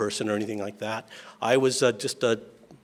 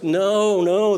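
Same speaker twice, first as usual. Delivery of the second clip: high, louder no from the big sister; emphatic correction; 8 semitones higher, 7 dB louder than usual. Retold or imitated. Sister correcting her brothers' mistake, high and loud. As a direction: cut them off like an exasperated older sister.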